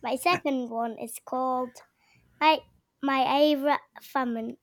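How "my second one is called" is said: normal talking voice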